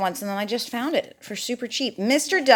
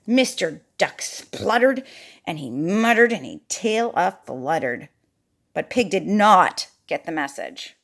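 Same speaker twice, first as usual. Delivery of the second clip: usual speaking voice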